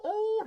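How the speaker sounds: high-pitched imitated "oh" wail; mock emotional cry. Family at military homecoming, mock high wail. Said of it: "and they go".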